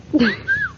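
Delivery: high, short giggle; playful high-pitched laughter; amused giggle. Acted. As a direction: burst into a little tickled laugh. Laughs at being called little hussy.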